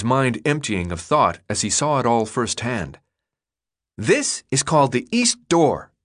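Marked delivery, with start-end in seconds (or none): 4.0-5.9 proud reveal. Acted. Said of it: "as if proudly revealing a piece of art".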